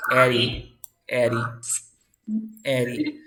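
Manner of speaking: "Eddie" with the D flapped like Spanish R; tapped D in Eddie. Shown as a demonstration. Teacher modelling the name, "Eddie" with a tap. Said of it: "we pronounce this like Eddie".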